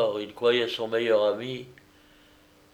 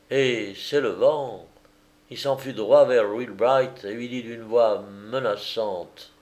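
usual speaking voice

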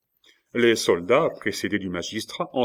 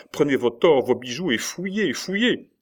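raised voice climbing to a high cry; urgent plea; 6 semitones higher, 4 dB louder than usual. A narrator's reading. Nurse begging her master to flee, frantic plea. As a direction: beg him to grab everything and run.

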